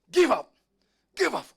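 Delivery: king's forceful high shout; loud command. Retold or imitated. King demanding the prisoner's surrender aloud, forceful shout. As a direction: bellow it, falling off at the end. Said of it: "katakanlah dengan suara keras".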